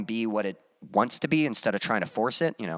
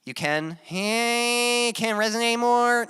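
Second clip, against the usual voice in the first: nasal voice; 13 semitones higher, much slower than usual. Shown as a demonstration. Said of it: "like in the nose".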